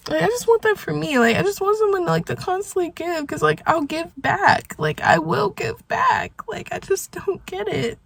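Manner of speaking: high, soft voice on the verge of crying; tearful. Real, not acted. Wanting someone who gives back, tearful. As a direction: confess the hurt in a small, high voice.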